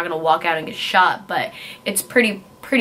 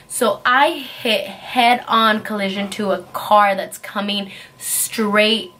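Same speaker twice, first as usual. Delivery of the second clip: ordinary talk, nothing marked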